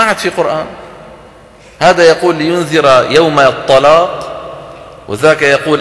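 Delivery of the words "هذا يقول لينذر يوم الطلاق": ordinary talk, nothing marked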